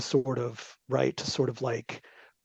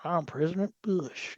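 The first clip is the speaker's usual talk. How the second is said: lightly voiced Southern twang, a bit softer; George W Bush twang; 3 semitones higher, 4 dB quieter than usual. Joking about a president's folksy Southern twang. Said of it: "He, he always had this kind of twang".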